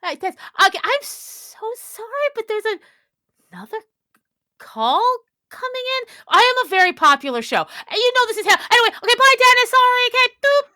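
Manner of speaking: high, hurried radio host brushing off a caller; a fluster of high, bright on-air apologies. Acted. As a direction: babble apologies in a high rush.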